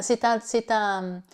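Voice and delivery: ordinary talk, nothing marked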